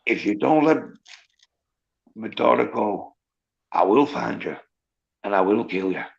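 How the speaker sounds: low, quiet Irish menace; Liam Neeson impression. Shown as a demonstration. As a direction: deliver a threat low and softly. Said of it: "a right good Liam Neeson impression".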